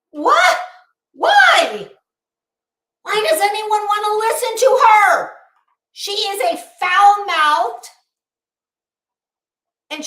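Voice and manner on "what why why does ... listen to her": exasperated disbelief. Retold or imitated. Exasperated outburst at viewers.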